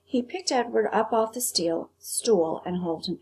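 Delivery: ordinary delivery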